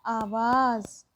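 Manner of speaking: आवाज़ drawn out slowly, ending on a clear z; slow demo of आवाज़ with a buzzing z; slow nukta demo. Shown as a demonstration. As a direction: stretch आवाज़ and buzz the final ज़. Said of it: "जैसे कि हो गया".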